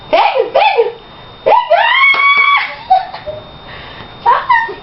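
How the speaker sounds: high squealing encouragement; excited urging. Real, not acted. Urging the baby to grab.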